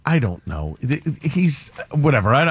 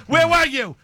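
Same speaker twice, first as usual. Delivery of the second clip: higher, mock accusing where were you; Goodfellas mother-in-law; 10 semitones higher than usual. Retelling the Goodfellas scene, mock accusing. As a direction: throw the question like a nagging in-law. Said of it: "It's the scene in Goodfellas".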